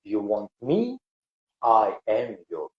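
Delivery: soothing voice of the Lord. Acted. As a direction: breathe it like a spring breeze. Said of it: "a soothing, beautiful, like a breeze".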